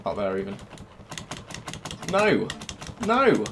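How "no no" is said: real outraged shout. Real, not acted. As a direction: yell no at the screen. Player loses the fight in game.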